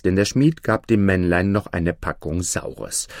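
ordinary delivery

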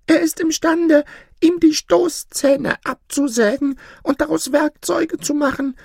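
very high, insistent warning; urgent warning voice. Acted. Dream voice warning the goose about the son of Adam, urgent. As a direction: warn the goose urgently, pitch climbing.

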